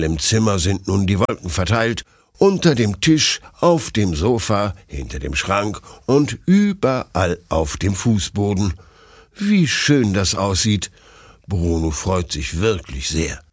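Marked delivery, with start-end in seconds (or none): none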